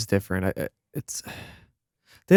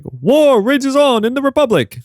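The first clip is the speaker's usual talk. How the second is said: much higher, loud dramatic trailer voice; comic book narrator; 6 dB louder than usual. Retold or imitated. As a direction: announce it like an epic cartoon intro. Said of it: "comic book like voice by Tom Kane".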